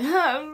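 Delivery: imitated pushing grunt; effort grunt. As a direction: huff it out like a hard push.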